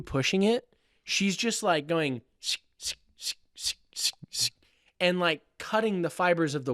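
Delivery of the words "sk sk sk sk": soft unvoiced sk sk of a blade slicing; imitated slicing sound. Shown as a demonstration. Showing how the TA's chisel sliced wood, breathy sk sounds. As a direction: breathe out little cutting noises.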